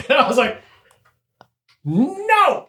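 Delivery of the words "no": high, drawn-out no; flat-out refuse, hammed up; mock refusal. Imitating his own refusal to a drunk coworker.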